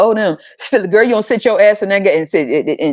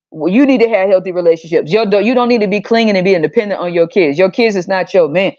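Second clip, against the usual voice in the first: usual speaking voice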